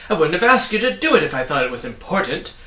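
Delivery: loud, fast, biting snarl; angry snarl. A narrator's reading. Villain snarling at Milo and Tock, angry. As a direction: rush it out with contempt. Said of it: "he snarled angrily".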